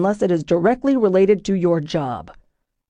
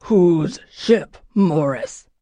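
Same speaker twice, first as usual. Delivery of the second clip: growled; 3 semitones lower, much slower than usual. Acted. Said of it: "the captain growled at me".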